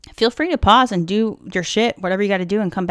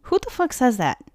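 ordinary talk

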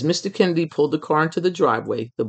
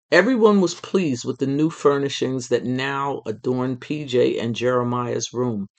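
usual speaking voice

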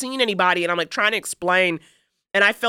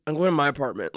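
lower-pitched retold deadpan; deadpan, annoyed; 4 semitones lower, faster than usual. Imitating the stoic neighbor heading home, deadpan and annoyed. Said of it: "he had a d-, very deadpan face".

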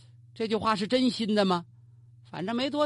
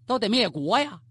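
ordinary delivery

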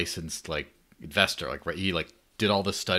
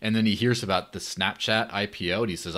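ordinary talk, nothing marked

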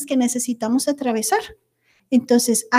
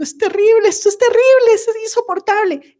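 high, loud panicky exclamations; mock panic; 9 semitones higher, 3 dB louder than usual. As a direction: play the person spiralling into panic. Acting out catastrophic self-talk. (pánico fingido, agudo y fuerte)

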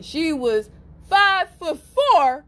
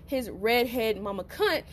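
ordinary talk

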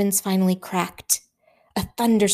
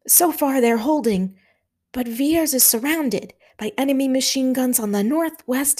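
lightly voiced urgent officer, higher; a hint of a tense Highland officer; Scottish officer Hamish; 6 semitones higher than usual. A narrator's reading. Hamish briefing Stanley under shellfire, lightly voiced.

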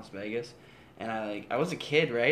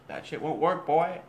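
mock website refusal; 5 semitones higher, 7 dB louder than usual. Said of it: "sometimes it's like".

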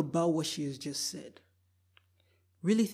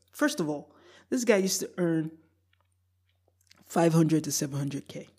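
ordinary delivery

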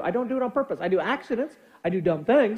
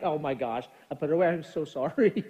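softer, lower acted apology; apologetic; 5 semitones lower, 5 dB quieter than usual. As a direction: sheepishly own up, voice dropped.

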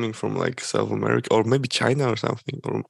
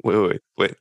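ordinary delivery

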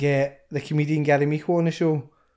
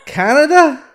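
high, loud thrilled echo; excited; 11 semitones higher, 9 dB louder, slower than usual. Real, not acted. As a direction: answer with a thrilled shout. Echoing the hyped reveal.

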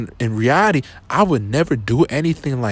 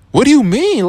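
much higher and louder, shocked disbelief; incredulous; 12 semitones higher, 6 dB louder than usual. Retold or imitated. Retelling his reply to the girl who dumped him, high and incredulous. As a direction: throw the question back in stunned disbelief. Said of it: "And I'm just like".

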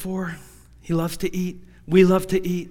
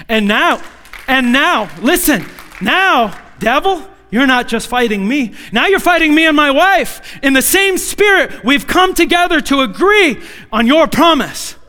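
rising preacher's call, louder and higher; rally the church against the devil; preaching; 6 semitones higher, 6 dB louder than usual. Acted. Preacher declaring war on the devil with full force.

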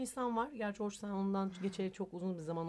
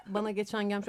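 ordinary talk, nothing marked

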